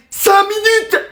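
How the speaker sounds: very high, incredulous repeat; indignant echo. Acted. Mother hears son's delay, outraged. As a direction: throw it back, scandalized.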